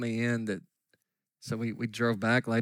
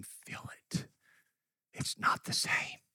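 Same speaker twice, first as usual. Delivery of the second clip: hushed; 8 dB quieter, slower than usual. Real, not acted. Preacher whispering to the congregation about a deathbed. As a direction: drop to a whisper.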